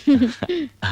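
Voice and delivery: a short amused laugh; sudden high laugh. Real, not acted. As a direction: burst of high-pitched laughter. Father laughs at son's retort.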